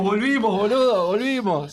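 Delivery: loud excited cry; excited shout. Acted. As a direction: shout it out in triumph. Pepe celebrates loudly.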